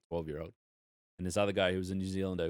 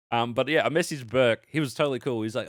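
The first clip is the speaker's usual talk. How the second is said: usual speaking voice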